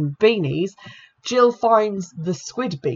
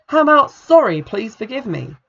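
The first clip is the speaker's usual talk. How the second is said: sharp, loud start, then clipped; mock demand; 5 semitones higher, 6 dB louder than usual. A narrator's reading. Kathy demanding Jill apologise, sharp and louder. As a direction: snap the apology out as an order.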